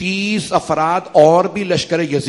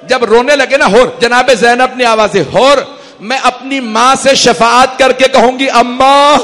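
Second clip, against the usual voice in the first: louder, higher grieving recitation, building; rising majlis lament; 5 semitones higher, 7 dB louder than usual. Acted. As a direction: let the lament swell toward tears. Masaib climax, Hur addresses the ladies. (بلند ہوتی ہوئی پر سوز مصائب خوانی)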